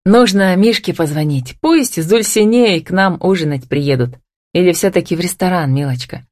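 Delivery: plain reading voice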